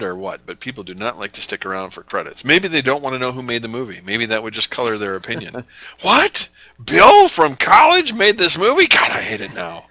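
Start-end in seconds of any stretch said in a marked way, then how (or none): 6.0-9.9 outraged moviegoer. Pretend. Mocking moviegoers who skip credits.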